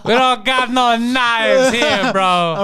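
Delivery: put-on slow, laughing Greek accent; hammed-up Greek accent, slowly. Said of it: "I had to do the Greek voice".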